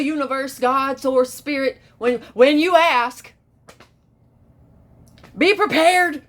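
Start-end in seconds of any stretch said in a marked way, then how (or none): 5.4-6.2 high, loud proclamation; punched, loud warning; emphatic warning. Pretend. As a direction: announce it dramatically, pitched way up. Dramatic warning after pause.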